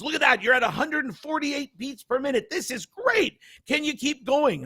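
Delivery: high-pitched, thrilled cheering-on; excited nurse. Retold or imitated. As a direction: imitate an excited nurse urging someone on. Nurse cheering runner on, retold.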